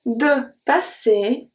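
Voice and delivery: De passer said very slowly, er as e; slow pronunciation demo. Shown as a demonstration. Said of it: "E R make the sound E".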